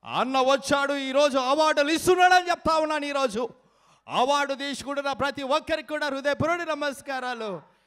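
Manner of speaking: high, loud crowd address; politician impression. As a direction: proclaim it like a rally speaker, easing off. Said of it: "unique mimicry concept". (హెచ్చు స్థాయిలో బిగ్గరగా నాయకుడి ప్రసంగం)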